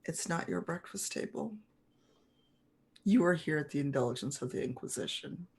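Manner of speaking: soft, measured spy's rebuke; leaning-in spymaster. Acted. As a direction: drop the volume and lean in close. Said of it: "he leans in a little".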